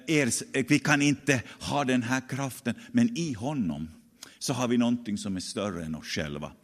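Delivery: usual speaking voice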